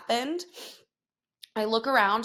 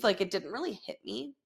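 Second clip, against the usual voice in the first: ordinary talk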